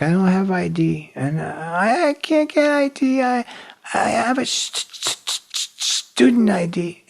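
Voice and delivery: high, whiny fake sobbing with stammer; mock crying. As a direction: stammer through a put-on tearful plea. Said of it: "I was, started crying".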